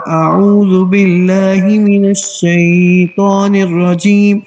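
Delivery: slow recitation with every vowel stretched far too long; exaggerated stretched Quran recitation; overlong recitation. Shown as a demonstration. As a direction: drag the phrase out as a warning of too much lengthening. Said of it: "بہت زیادہ جس طرح".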